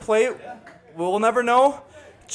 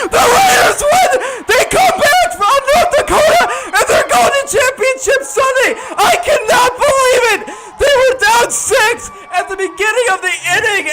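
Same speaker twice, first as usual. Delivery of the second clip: screaming, much higher and louder, laughing through it; ecstatic walk-off call; 8 semitones higher, 4 dB louder than usual. Real, not acted. Announcer screaming as the Raiders win the game. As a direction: yell the winning call with everything you have.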